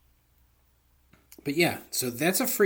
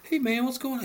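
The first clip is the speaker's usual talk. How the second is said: a light, much higher, chirpy greeting; friendly local guy; 14 semitones higher, 4 dB louder, faster than usual. Retold or imitated. A townsperson greeting the grumpy Cooper, high chirpy voice. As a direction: play a cheery neighbour calling out.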